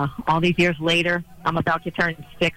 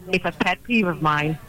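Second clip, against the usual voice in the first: usual speaking voice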